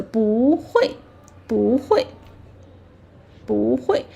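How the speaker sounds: each 不 rising, each 会 falling; rising then falling. Shown as a demonstration. Teacher drills 不会 for learners, rising then falling. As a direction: model 不会 with 不 gliding up. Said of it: "rising tone".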